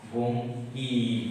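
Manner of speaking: Bankim drawn out long for pupils to copy; slow drawn-out word. Shown as a demonstration. Teacher to pupils, spelling word drawn out slowly. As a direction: stretch Bankim very slowly, syllable by syllable. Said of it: "কি আছে?".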